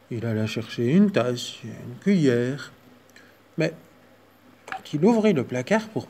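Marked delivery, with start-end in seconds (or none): none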